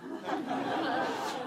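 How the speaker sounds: quiet unvoiced chuckle of relief; soft breathy laugh. Real, not acted. Speaker recalling the CEO's prank to students, soft laugh. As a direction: let a soft laugh slip out at the memory. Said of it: "So I just started laughing".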